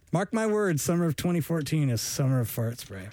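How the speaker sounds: usual speaking voice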